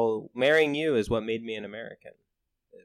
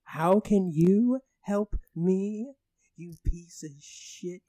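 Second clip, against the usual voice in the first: put-on British accent; 4 semitones higher, 4 dB louder than usual. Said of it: "I do a great British accent".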